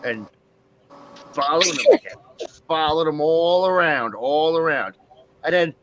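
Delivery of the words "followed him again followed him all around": ordinary delivery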